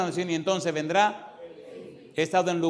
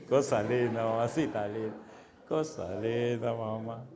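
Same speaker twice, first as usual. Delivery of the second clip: low, soft, lingering coo; fond teasing; 6 semitones lower, 5 dB quieter than usual. Acted. Pastor's aside about the unbelieving caller, fondly teasing. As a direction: coo it indulgently, low and quiet.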